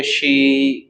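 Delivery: शी with the vowel stretched long; drawn-out vowel. Shown as a demonstration. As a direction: hold the ee of शी. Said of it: "इसको हम खींचते हुए नहीं पढ़ेंगे".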